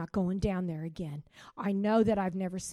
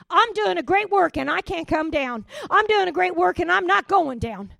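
high, loud, emphatic proclamation; triumphant refrain belted out; preaching shout; 10 semitones higher, 10 dB louder than usual. Real, not acted. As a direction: declare it with full force. Congregation declaration, preaching.

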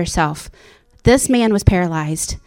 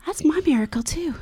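joyful realisation; 6 semitones higher, 7 dB quieter than usual. Retold or imitated. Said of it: "And I'm like".

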